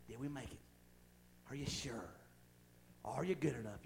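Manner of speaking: hushed, low questions with gaps between; quiet doubting. Acted. Devil keeping believers wondering if they made it, quiet doubting. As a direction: drop low and plant each doubt softly.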